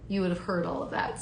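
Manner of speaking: usual speaking voice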